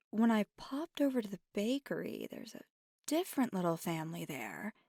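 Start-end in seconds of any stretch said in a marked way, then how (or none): none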